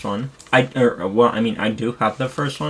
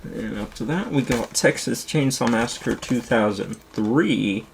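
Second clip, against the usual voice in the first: usual speaking voice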